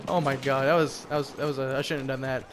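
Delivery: ordinary delivery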